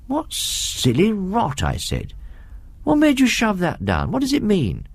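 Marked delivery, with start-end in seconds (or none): 0.1-1.6 put-on higher, slow scornful boy's voice; scoffing schoolboy. A narrator's reading. Schoolboy scoffs at his friend's odd Latin sentence, higher and scornful. 2.8-4.9 put-on higher, pressing boy's voice; puzzled schoolboy. A narrator's reading. Schoolboy presses MacLeod about the sentence, higher and insistent.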